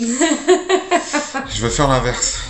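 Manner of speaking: laughing. Real, not acted. Laughing while choosing answer.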